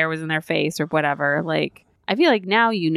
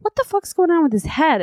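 higher-pitched, incredulous retold question; exaggerated disbelief, pitched up; mock bafflement; 10 semitones higher, 4 dB louder than usual. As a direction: replay your bewildered outburst. Retelling own baffled question during film.

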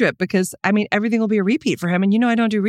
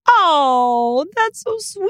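pleasantly surprised; 7 semitones higher, much slower than usual. Real, not acted. Hears Jeremy loves her most.

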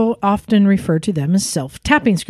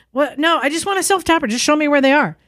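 impatient customer; 8 semitones higher, faster than usual. Retold or imitated. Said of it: "they're just like".

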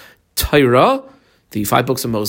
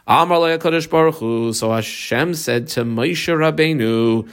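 ordinary talk, nothing marked

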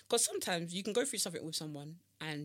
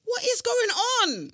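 high, loud, drawn-out exclamation; exasperated outcry; 13 semitones higher, 10 dB louder, much slower than usual. Acted. Friend caps her rant about online posts, exasperated outcry. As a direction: cry it out slowly in mock despair. Capping the mock-outrage rant.

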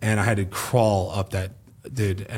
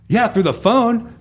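sarcastic reply; 11 semitones higher, 4 dB louder, slower than usual. Retold or imitated.

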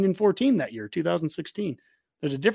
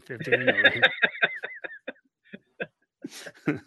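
laughing; 10 semitones higher than usual. Retold or imitated. Repeating the prediction, laughing.

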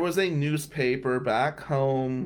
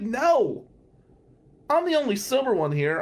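indignant no; 7 semitones higher, 3 dB louder than usual. Retold or imitated. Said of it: "I'm like".

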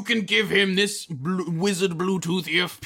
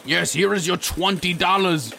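ordinary talk, nothing marked

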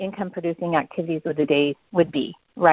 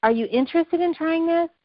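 eager offer; 11 semitones higher than usual. Retold or imitated. Offering oils to park mom.

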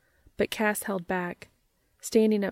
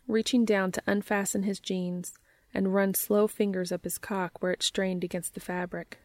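usual speaking voice

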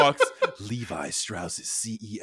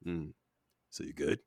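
mock low check-in; 9 semitones lower, 13 dB quieter than usual. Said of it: "And then he's like".

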